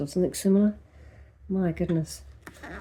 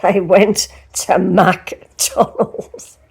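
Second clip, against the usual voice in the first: very slow, word-by-word disbelief, louder on WENT; incredulous; 5 dB louder, much slower than usual. Real, not acted. Host appalled at guests choosing McDonald's in Paris, incredulous. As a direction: drag out "went to McDonald's" in amazement.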